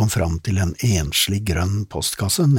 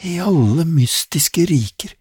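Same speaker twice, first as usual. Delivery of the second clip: put-on high girlish cry, much higher; excited exclamation; 10 semitones higher, 4 dB louder than usual. Girl Lizzie exclaims at the dark car, high excited. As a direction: Lizzie bursts out in wonder. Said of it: "utbrøt Lizzie".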